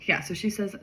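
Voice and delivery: plain reading voice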